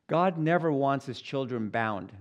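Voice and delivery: ordinary delivery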